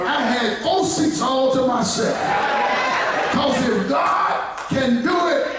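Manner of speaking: high shouted preaching climax; slowed, shouted sermon peak; preaching at full force. Acted.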